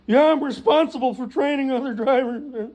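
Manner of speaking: high, loud mock brag to mom; boastful son. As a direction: puff up like a wonder boy phoning mom. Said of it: "Every week he calls his mom".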